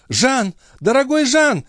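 very high pleading call; crying out. A narrator's reading. Chon calls to Jean from the carriage, crying out. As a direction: call out to him high and urgent. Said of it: "кричала Шон".

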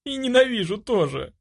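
high, tearful protest; sobbing, high-pitched admission; pitiful sobbing. A narrator's reading. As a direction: whimper it defiantly. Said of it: "жалко всхлипывая".

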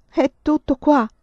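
cries it out in grief, loud and high; shouting. A narrator's reading. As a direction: wail it out through tears. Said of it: "gridò".

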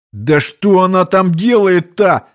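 high, drawn-out alarmed question; nearly shouting. A narrator's reading. Man bitten on the neck demanding answers, nearly shouting. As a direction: raise the pitch and almost yell. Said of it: "почти крикнул я".

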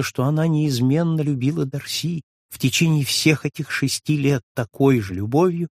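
ordinary delivery

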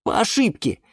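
high, distressed confession; self-reproach. A narrator's reading. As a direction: blame yourself, flustered. Юра винит себя. (сокрушённо, высоким голосом)

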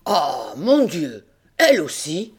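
put-on an old farmer dismayed by his goat; stunned cry. A narrator's reading. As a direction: gasp it out in disbelief. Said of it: "cria monsieur Seguin stupéfait".

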